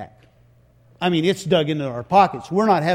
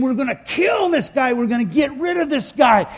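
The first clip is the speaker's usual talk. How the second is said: high, loud shout of an angry crowd; heated, high-pitched crowd shouting; acted mob threat; 8 semitones higher, 4 dB louder than usual. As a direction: yell it as a riled-up mob. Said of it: "and they're saying".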